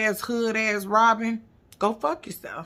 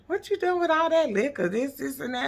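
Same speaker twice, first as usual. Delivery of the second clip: scolding mama; 8 semitones higher than usual. Retold or imitated. [name] voicing her mama scolding.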